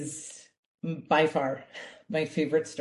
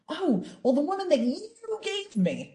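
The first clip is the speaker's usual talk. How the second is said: higher, whiny blame-shifting Adam; finger pointing; 12 semitones higher than usual. Acted. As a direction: pass the blame like a caught kid. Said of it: "immediately point the finger".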